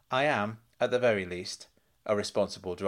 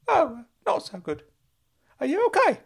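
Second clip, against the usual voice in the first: put-on much higher, loud excited old uncle voice; high, loud eager uncle; Uncle Claude calling out; 6 dB louder than usual. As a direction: squeal his name like a giddy fussing uncle.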